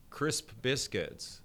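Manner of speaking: CRISP BISCUITS drawn out word by word; slow, careful saying. Shown as a demonstration. Coach showing athletes the test words, slow and deliberate.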